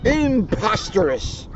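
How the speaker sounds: slow, stretched dismayed outcry; dismayed cry. Acted. Tigger sees his stripes are back, crying out slowly. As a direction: cry it out in disbelief. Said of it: "cried Tigger".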